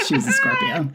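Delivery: high, loud laughing exclamation; laughing shout. Real, not acted. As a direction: shriek it out through laughter. Insisting, laughing at the friend.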